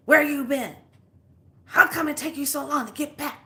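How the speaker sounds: loud, high mock scolding; imitated yelling. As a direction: imitate an angry man yelling at his fiancée. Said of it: "I heard him yelling at you".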